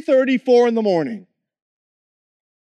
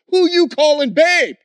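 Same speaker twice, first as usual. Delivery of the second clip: louder, higher jealous snap; jealous challenge; 4 semitones higher, 6 dB louder than usual. Retold or imitated. Jealous soldier confronting the other guard, loud and higher. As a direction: call him out like a jealous man. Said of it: "another guy comes out of the house and goes".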